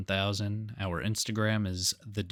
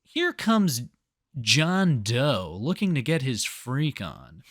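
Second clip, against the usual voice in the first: Chris Hansen impression; 3 semitones higher, slower than usual. Imitating the TV host revealing the predator. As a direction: ham up the reality-show host reveal. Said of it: "Chris Hansen's like".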